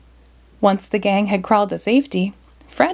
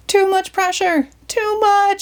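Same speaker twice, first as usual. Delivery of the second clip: much higher panicked yell; Shaggy yelling; 11 semitones higher than usual. Acted. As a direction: cry out as the hose lifts you. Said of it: "Shaggy yelled".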